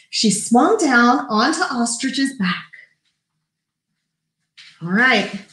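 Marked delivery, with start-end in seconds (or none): none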